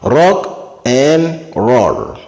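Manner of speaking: "Rock and roll" very slowly, each word held; long, separated words in "Rock and roll"; musical drawl. Shown as a demonstration. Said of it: "c'est un truc musical bizarre".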